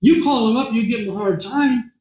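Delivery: ordinary talk, nothing marked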